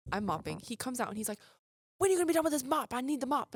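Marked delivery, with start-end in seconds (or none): 2.0-3.5 loud, higher, demanding cook; impatient cook. Retold or imitated. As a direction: push to get the mop back. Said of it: "literally responded in the country accent".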